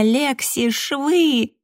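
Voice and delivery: high, frantic cry of alarm; frantic yell. A narrator's reading. Justin yells after the running narrator, frantic and high. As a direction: yell a warning in panic, voice shooting up. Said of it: "истошные вопли Джастина".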